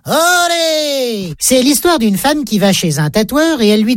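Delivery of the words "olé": a high, bullfighter-style Olé; exclaiming Olé. A narrator's reading. Housewife dodges the falling child, matador's high Olé. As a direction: swing it out like a matador.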